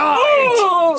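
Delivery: a high, sudden startled cry. Acted. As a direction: cry out in alarm.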